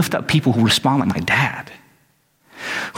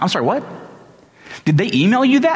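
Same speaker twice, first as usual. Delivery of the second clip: high, disbelieving question; incredulous; 8 semitones higher, faster than usual. Retold or imitated. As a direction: question him in rising disbelief. Son hears dad's discriminatory rejection.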